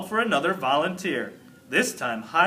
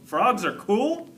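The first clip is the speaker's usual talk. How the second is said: high, slow disbelieving question; can't believe what you heard; incredulous; 7 semitones higher than usual. Acted. Garth's poem repeated in disbelief.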